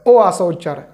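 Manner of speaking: usual speaking voice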